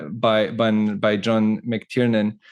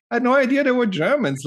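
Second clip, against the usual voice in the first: high-pitched amazed disbelief, pitched way up; retold surprise; 12 semitones higher than usual. Watching the movie, shocked they were Germans, high surprised voice. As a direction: squeak it out like the news just hit you. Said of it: "I was like".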